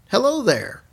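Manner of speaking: a high, lightly voiced mother greeting on the line; mother's voice. Dead mother greets her daughter over the phone, high and light. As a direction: lift into a hint of an old Norwegian mom saying hello. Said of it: "very unique voice, and she had a really thick Norwegian accent".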